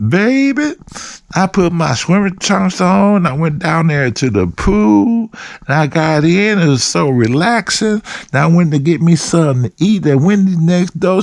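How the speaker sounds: high-pitched caricature of a woman chatting; imitating her voice. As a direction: draw it out in a high put-on female voice. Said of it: "this is how she talked".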